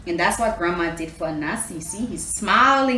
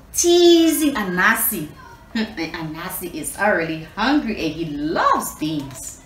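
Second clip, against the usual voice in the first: usual speaking voice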